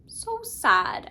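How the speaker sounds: ordinary talk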